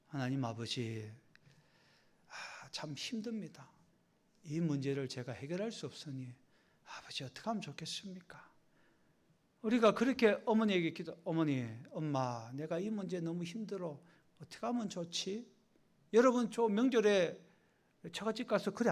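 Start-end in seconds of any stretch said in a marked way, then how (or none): 0.1-8.5 much softer, lower confiding plea; intimate prayer. Pretend. 11.2-15.4 put-on confiding child.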